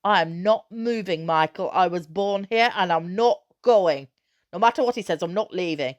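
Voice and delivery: mother tearfully refusing to leave home; fierce tearful defiance. A narrator's reading. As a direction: hold back tears and refuse firmly. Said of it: "her eyes filled with fierce tears".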